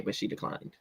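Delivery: soft whispered aside. Real, not acted. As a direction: whisper it like gossip. Whispered gossip.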